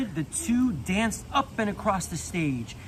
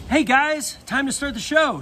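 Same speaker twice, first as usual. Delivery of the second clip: loud and high announcing shout; 7 semitones higher, 5 dB louder than usual. Acted. Dolly calling the toys to the show, shouting. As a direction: call everyone to their seats. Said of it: "shouted Dolly".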